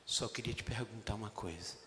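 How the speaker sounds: low, soft, gentle question; tender Jesus. Acted. Jesus gently asking Peter if he loves him, tender. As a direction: ask it tenderly, hushed. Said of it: "Jesus bateu com doçura".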